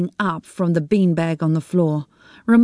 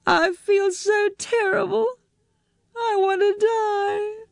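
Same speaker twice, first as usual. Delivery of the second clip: higher, slow, tearful and breaking; crying despair; 14 semitones higher, slower than usual. Acted. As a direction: near sobs, drawn out. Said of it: "are you crying?".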